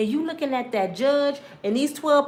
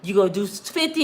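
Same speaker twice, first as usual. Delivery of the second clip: ordinary talk, nothing marked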